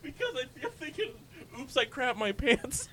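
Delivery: high, laughing through words; giggling out the quote. Real, not acted. Laughing while quoting him.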